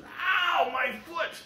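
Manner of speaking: sudden high yelp of pain. Acted. Man in a bear trap yelps in sudden pain. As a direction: yelp as the trap snaps shut.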